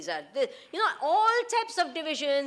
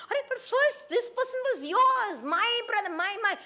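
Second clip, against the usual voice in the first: possessive sibling; 8 semitones higher than usual. Retold or imitated.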